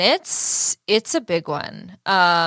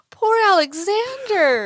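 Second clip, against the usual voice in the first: mock pity; 13 semitones higher, slower than usual.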